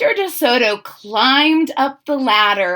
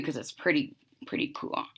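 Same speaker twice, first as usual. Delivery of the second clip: hushed; 6 semitones lower, 13 dB quieter, faster than usual. Real, not acted. Teacher marvels at picture.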